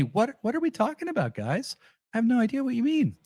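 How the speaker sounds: high, mock-innocent feigned confusion; high coy feigned cluelessness; mock innocence. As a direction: play dumb, all wide-eyed. Mock innocent reply to fan.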